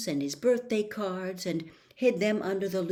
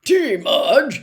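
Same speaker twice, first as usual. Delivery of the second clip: higher, louder hearty host; jovial; 6 semitones higher, 13 dB louder than usual. Acted. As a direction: welcome her with booming cheer. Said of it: "smiling jovially".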